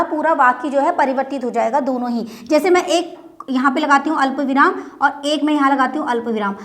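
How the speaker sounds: ordinary talk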